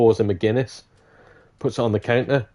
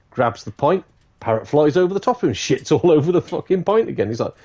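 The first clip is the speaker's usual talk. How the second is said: ordinary delivery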